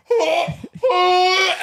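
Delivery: choking gasp. Retold or imitated. Kid hit in throat by football.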